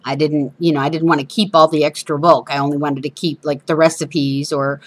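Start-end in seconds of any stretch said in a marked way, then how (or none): none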